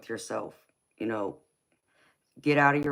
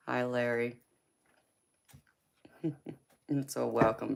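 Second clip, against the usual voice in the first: ordinary talk, nothing marked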